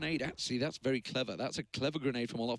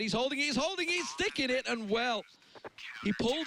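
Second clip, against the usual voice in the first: excited; 9 semitones higher than usual. Real, not acted. Caster hyped at clutch defuse.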